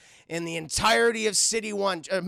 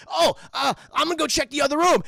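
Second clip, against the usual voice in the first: louder, higher kid-officer imitation; eager rookie; 6 semitones higher, 6 dB louder, faster than usual. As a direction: pipe up like a keen young cadet. Voices eager young rookie.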